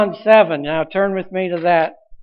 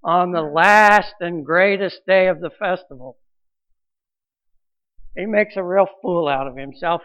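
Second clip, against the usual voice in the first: ordinary talk, nothing marked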